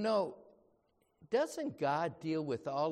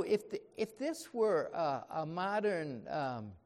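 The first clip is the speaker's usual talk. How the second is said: ordinary delivery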